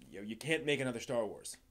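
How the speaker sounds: normal talking voice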